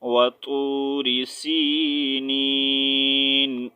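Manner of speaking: slow melodic tajweed recitation; Quran recitation. Shown as a demonstration. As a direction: draw out سِينِينَ and stop. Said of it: "تو کیا sound آئے گا؟". (آہستہ ترتیل سے تلاوت)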